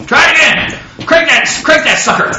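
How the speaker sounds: high, pushy troubleshooting mechanic; eager car mechanic shouting instructions; urging mechanic. Retold or imitated. Mechanic cranking an engine.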